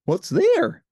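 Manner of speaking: high, bright Muppet Ernie voice; cheerful Ernie, pitched well up. A narrator's reading. Ernie answering Bert, in a high cheerful voice.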